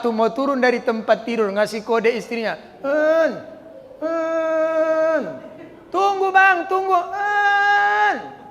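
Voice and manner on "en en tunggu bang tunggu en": high, very slow slurred pleading; hammed-up slurred cry for help; slurred stroke husband. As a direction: beg with a twisted mouth, slow and strained.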